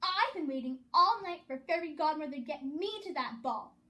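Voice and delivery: loud, complaining rant; indignant screech. A narrator's reading. Cinderella complaining to the fairy godmother, loud and indignant. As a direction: whine furiously at being left out. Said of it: "she screeched".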